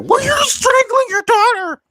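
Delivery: very high, loud, drawn-out mock accusation; hammed-up high-pitched shouted question; Vicky impression, accusing. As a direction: screech it slowly like an outraged housewife. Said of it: "I want Vicky to be like".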